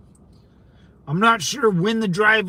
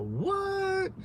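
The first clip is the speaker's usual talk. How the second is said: soft, very high drawn-out disbelief; incredulous surprise; 13 semitones higher, 8 dB quieter than usual. Real, not acted. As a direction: squeal it softly, way up high. Shock that pot is illegal.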